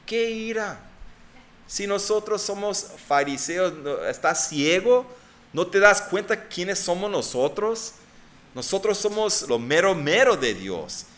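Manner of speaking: arrogant Pharisees. Retold or imitated. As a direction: sneer as if they are God's elite. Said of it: "Ya me imagino los fariseos pensando".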